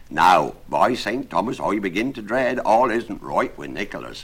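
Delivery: put-on rustic old-world carpenter, loud and anxious; loud, emphatic carpenter full of dread; worried carpenter. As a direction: fret aloud as a simple tradesman.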